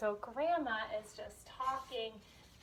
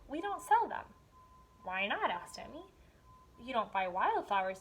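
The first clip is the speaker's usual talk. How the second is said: lively storybook reading with voiced characters; story read aloud; 6 dB louder than usual. Teacher reading a picture book to young students, animated. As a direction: read it to the class with expression.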